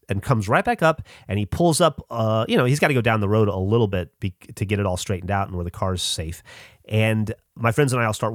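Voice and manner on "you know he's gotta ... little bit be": ordinary delivery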